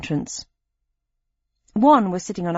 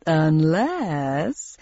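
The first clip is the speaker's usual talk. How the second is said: game show host voice; 3 semitones lower than usual. Acted.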